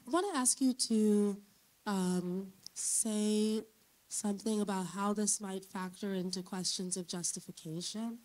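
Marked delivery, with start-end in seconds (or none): none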